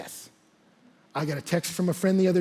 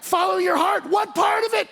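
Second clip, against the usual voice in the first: high, loud mocking cry of what part; exasperated shout; 12 semitones higher, 3 dB louder than usual. Real, not acted. Preacher mocking the crowd's slogan, high loud shout.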